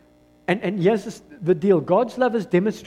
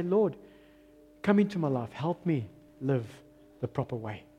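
humble prayer; 5 semitones lower, 6 dB quieter than usual. Acted. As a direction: quietly ask the Lord for help. Acting out a prayer of surrender.

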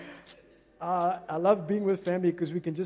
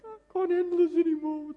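high-pitched, hammed-up crying voice; exaggerated fake weeping complaint; mock tearful devil; 9 semitones higher than usual. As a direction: whine it out as a pitiful sobbing devil. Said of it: "he's crying so much".

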